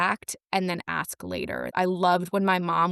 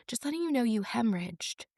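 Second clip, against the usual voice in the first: imitating the resident, soft and higher; casual matter-of-fact; 5 semitones higher, 6 dB quieter than usual. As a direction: break bad news offhandedly, softly. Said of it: "he said it as if it was just so natural".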